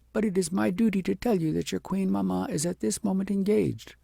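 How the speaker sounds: a nurse gently refusing. A narrator's reading. Nurse explains.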